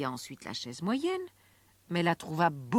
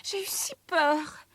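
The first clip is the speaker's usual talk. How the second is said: put-on frightened girl; 12 semitones higher, 3 dB louder than usual. A narrator's reading. Frightened girl runs to mother.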